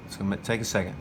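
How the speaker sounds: normal talking voice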